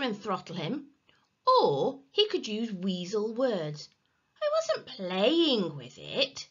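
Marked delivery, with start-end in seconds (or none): 4.4-6.5 slow, oily denial; smooth. A narrator's reading. Henry using weasel words on Peter, smooth. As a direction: glide through the excuse. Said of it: "said Henry smoothly".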